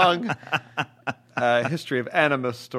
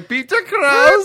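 very high, drawn-out shout; comic holler; 11 dB louder, much slower than usual. Acted. Host announces the show's star, in a high comic shout. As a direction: scream the name, stretching it long. Yells the actor's name.

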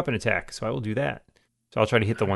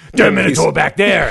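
growly death metal retake, laughing through it; hammed-up metal growl, louder and higher; laughing mock death metal growl; 4 semitones higher, 7 dB louder than usual. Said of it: "like you were death metal".

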